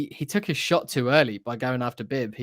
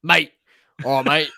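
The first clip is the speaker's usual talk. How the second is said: a short, louder New Zealand "mate" greeting; Kiwi mate; 5 dB louder than usual. Retold or imitated. Podcaster imitating a Kiwi character greeting the bounty hunter, in a light New Zealand accent. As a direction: toss off a Kiwi "mate" like a bloke. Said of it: "the most sort of Kiwi".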